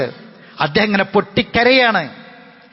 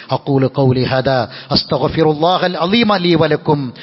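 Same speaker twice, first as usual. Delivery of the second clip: closing supplication; 6 semitones lower, slower than usual. Acted. Closing Arabic formula.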